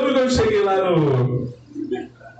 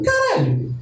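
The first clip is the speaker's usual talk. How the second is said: mock shock; 13 semitones higher, slower than usual. Reacting to the endless corridor.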